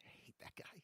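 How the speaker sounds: hissed under the breath, unvoiced; soft voiceless hiss of dislike; whispered grudge. Real, not acted. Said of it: "I got a little heated".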